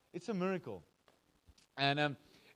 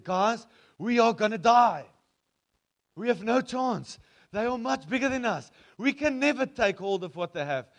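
panicked outcry; 5 semitones higher, 8 dB louder than usual. Retold or imitated. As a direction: shout it like doom is certain. Spies panic about giants.

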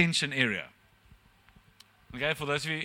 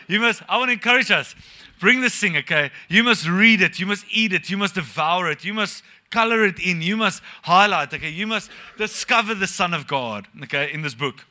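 loud, driving exhortation to read the Bible; preaching at full force; 5 dB louder than usual. Acted. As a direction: preach it out, pounding every must. Urging the church to read.

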